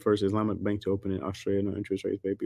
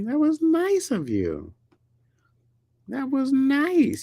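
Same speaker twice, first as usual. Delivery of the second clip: much higher, slow drawn-out sarcastic praise; sugary high mock compliment; slower than usual. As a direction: stretch out nice teasingly. Teasing friend about giving car.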